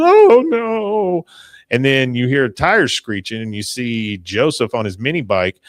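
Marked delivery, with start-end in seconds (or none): 0.0-1.2 high, loud cry of dismay; mock wail. Peggy told she won't want him back, mock wailing. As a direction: wail it out in exaggerated despair. Peggy wails at cop's bad news.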